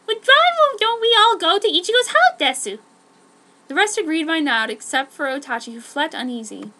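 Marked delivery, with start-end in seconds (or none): 0.1-2.8 put-on cutesy girl. Pudding's cute voice.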